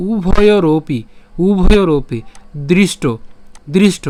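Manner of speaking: "উভয়রপি" loud and clearly measured, then repeated; "দৃষ্ট" set apart by pauses; pronunciation demo. Shown as a demonstration. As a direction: model "উভয়রপি", "দৃষ্ট" firmly for learners. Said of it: "উচ্চারণটা কেমন হচ্ছে এবার খেয়াল করুন".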